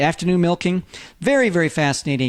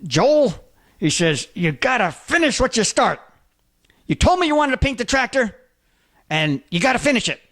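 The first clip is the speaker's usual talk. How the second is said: higher, stern warning through clenched teeth; 6 semitones higher than usual. Retold or imitated. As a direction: lay down the law like an angry farmer. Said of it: "he clenched his teeth and he says".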